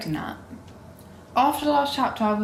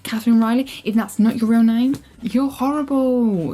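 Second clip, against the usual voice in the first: louder, indignant protest; outraged; 2 semitones higher, 4 dB louder than usual. Real, not acted. Reader loudly scolding the author over the plot.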